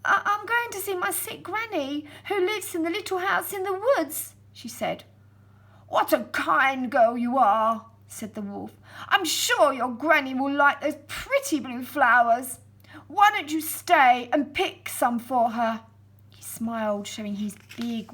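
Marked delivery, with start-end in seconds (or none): 0.1-4.3 put-on high, stammering frightened girl; a young girl caught off guard, stumbling on the first word; startled little girl. Girl answering the wolf, high and startled. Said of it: "jumped with fright". 5.9-7.9 flattering wolf. Acted. 9.1-15.8 coaxing wolf. Acted. Wolf tricks the girl.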